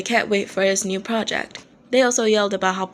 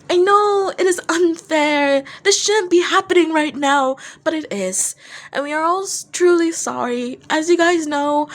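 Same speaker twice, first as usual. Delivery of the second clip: Dazai pleading; 7 semitones higher than usual. Acted. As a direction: beg the crowd to understand. Dazai giving the bad news.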